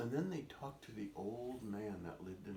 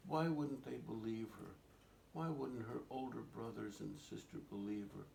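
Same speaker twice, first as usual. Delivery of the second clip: ordinary delivery, nothing marked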